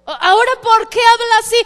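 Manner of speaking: high, loud astonished questions; amazed townsfolk. Retold or imitated. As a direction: voice the crowd's disbelief. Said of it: "se maravillaban y decían".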